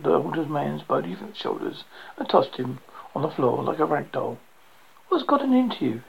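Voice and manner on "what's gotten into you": breathless, high startled gasp. A narrator's reading. Joshua just thrown off his attacker, gasping out the question. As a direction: gasp it out, shocked. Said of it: "he gasped".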